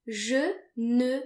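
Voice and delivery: teacher demo, slowly; slow, careful model. Shown as a demonstration. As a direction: say "Je ne sais pas" slowly with silent final S. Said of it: "then you want to say".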